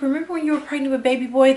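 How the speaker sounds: lightly voiced teasing brother; imitating brother. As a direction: rattle it off like a nosy brother. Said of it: "And he's like".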